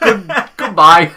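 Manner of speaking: loud, drawn-out sign-off; mock storming off. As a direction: end the retold call loudly, stretching it. Acting out storming off the call.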